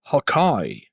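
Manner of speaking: low, coaxing villain voice; understated, persuasive character delivery; a bit sassy. Shown as a demonstration. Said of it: "That sounds a bit more sassy".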